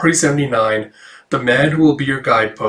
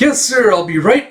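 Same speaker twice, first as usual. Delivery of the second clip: high, quick yes sir; eager reply; 7 semitones higher than usual. Acted. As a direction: answer brightly and hurry. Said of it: "He's like".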